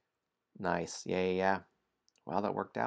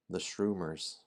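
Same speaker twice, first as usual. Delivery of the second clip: usual speaking voice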